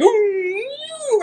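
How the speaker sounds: high, drawn-out "ooh" whoosh; whooshing sound effect. Retold or imitated. Showing how they bend down all quick, high playful whoosh. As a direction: mimic someone zipping down fast. Imitates zooming down with bending.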